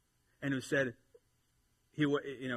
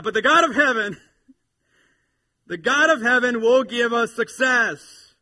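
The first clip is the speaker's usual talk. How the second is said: loud, high, stretched pulpit declaration; full-force scripture proclamation; bold proclamation; 11 semitones higher, 16 dB louder than usual. Real, not acted. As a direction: ring it out over the congregation.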